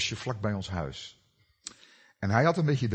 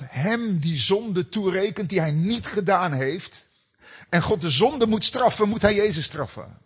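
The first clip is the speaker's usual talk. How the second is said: higher and louder, building to a forceful climax; full-force preaching; 7 semitones higher, 4 dB louder than usual. Acted. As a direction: preach it hard, rising to a loud peak. Preaching the atonement to congregation.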